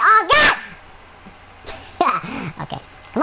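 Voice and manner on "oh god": high, loud startled cry. Real, not acted. Gamer shocked by a kill, startled cry. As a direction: shout in shock. Surprised by a game event.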